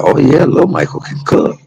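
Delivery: high, bright bragging mother voice, lightly done; a son imitating his mother proudly boasting; proud mama quote. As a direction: brag about your boy like his mama would.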